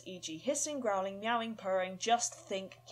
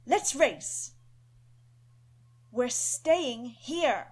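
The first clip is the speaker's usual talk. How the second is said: put-on stern boy; 4 semitones higher, 9 dB louder, much slower than usual. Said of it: "stern and serious".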